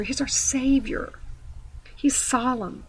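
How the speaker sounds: slow whispered awe; reverent whisper. Real, not acted. Preacher whispering reverently to her listeners. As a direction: breathe it out slowly in reverence.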